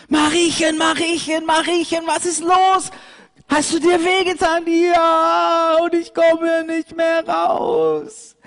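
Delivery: high, loud anxious lamb calling down; a panicked little lamb calling her friend; worried lamb. Acted. Pauli calls to Mariechen in the ditch, high and worried.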